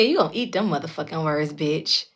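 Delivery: ordinary talk, nothing marked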